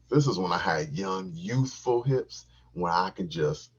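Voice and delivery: ordinary talk, nothing marked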